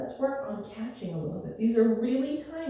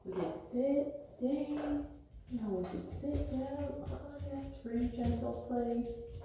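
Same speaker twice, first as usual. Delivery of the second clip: soft and slow; 9 dB quieter than usual. Real, not acted. Winding down with dog.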